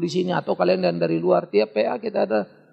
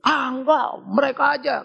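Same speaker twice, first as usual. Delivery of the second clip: dismissive denial; 6 semitones higher, 4 dB louder than usual. Retold or imitated. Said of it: "orang banyak ngomong".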